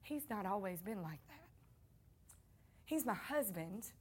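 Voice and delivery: hushed imitation of a discreet whisper; retold whisper. Woman leaning over to her pew neighbour about her husband, retold whisper. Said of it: "and she whispered".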